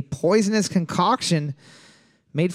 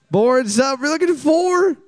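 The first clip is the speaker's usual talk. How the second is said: high, loud quiz host call; announcer call; 8 semitones higher, 3 dB louder, slower than usual. Acted. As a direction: boom it out like a game show host. Host reveal.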